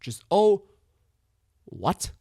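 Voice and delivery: "What?" set alone after a beat, as a baffled question. Shown as a demonstration. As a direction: leave a long gap before "What?" and lift it as a question. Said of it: "Oh dot What question mark".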